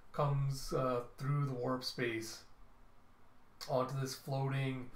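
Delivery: ordinary delivery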